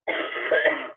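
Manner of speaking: harsh coughing fit; chesty cough. Real, not acted. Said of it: "So I'm coughing".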